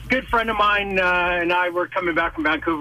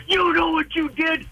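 high-pitched mock outrage; retold angry accusation; 10 semitones higher than usual. Sick buddy to driver, acting out an angry accusation. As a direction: imitate a buddy accusing you. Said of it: "he's swearing at me like crazy".

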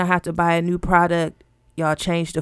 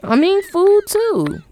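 high, slow exasperation; 13 semitones higher, 5 dB louder, slower than usual. Real, not acted. Drawn-out mock outrage.

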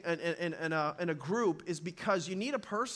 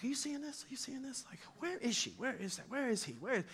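hushed, exasperated restaurant complaint; irritated husband whispering to his wife; agitated whisper; 3 semitones higher, 11 dB quieter than usual. Retold or imitated. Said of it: "And I'm like".

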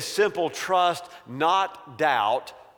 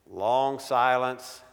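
normal talking voice

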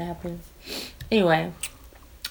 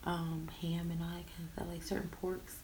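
whispering; 20 dB quieter than usual. Real, not acted. Whispering aside.

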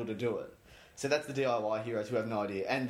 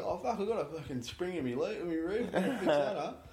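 higher, laughing tradie grumble; mock tradie; 5 semitones higher than usual. Tradie caught in the rain, laughing mock grumble. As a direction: ham up a soggy tradie's whinge. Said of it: "so people are going".

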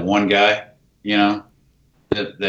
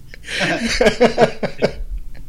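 high burst of laughter; real laughter; big laugh; 13 semitones higher than usual. Real, not acted. Laughing at his own story.

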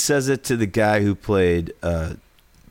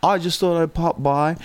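put-on English accent, pitched up; higher, airy mock English accent; 8 semitones higher than usual. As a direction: imitate an English singer casually dropping in. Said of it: "English, okay, good".

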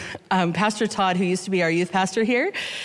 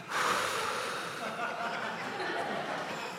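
a long, slow breath out to settle the nerves; soft exhale before reading scripture; long calming sigh; 14 dB quieter than usual. Real, not acted. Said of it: "I'm just gonna take a breath".

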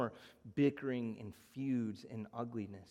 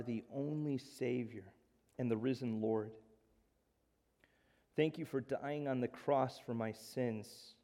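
leading a prayer. Acted. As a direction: pray gently for the listeners. Pastor leads closing prayer.

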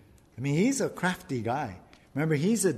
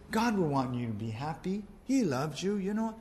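lightly voiced sly tempter; Satan tempting; 5 semitones higher than usual. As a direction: sell it gently, a little sly. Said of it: "or he says".

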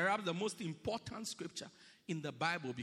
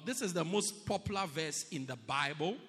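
ordinary talk, nothing marked

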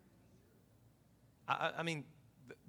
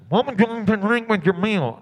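a loud, raised, put-on waitress voice; loud server voice; 4 semitones higher, 14 dB louder than usual. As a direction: call it out over the counter. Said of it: "She's like".

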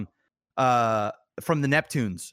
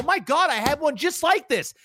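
high, amazed exclamation; stunned guest; 10 semitones higher than usual. Retold or imitated. Streamer seeing his own old fan, high amazed disbelief. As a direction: gasp it out in disbelief, pitched way up. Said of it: "And you go".

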